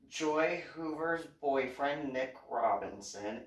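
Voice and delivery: ordinary talk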